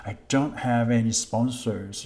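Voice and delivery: normal talking voice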